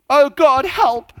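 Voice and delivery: high, loud panicked plea; hammed-up desperate "help"; mock cry for help. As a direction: cry out as if drowning.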